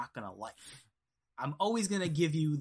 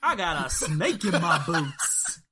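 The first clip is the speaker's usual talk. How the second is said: slow, loud, laughing cowboy catchphrase; Woody from Toy Story; 6 dB louder, slower than usual. Retold or imitated. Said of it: "human form of Woody from Toy Story".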